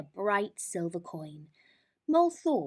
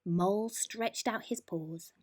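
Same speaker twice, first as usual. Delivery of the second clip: usual speaking voice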